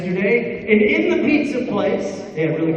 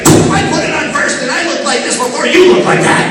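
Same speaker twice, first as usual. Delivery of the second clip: louder, higher, huffy schoolgirl; indignant friend; 5 semitones higher, 7 dB louder than usual. Pretend. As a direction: insist you wore it first. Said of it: "Your friend would be like".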